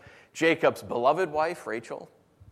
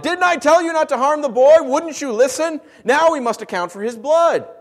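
higher, louder rebuke of his brothers; angry reproach; 11 semitones higher, 8 dB louder than usual. Acted. Reuben rebukes his brothers for harming the boy, loud and angry. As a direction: scold them for not listening.

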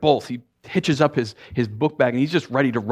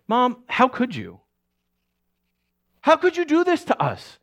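high, loud hurt accusation; fuming; 4 dB louder than usual. Retold or imitated. Resentful son confronting his mother, high and loud. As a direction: demand answers through anger. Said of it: "he was fuming".

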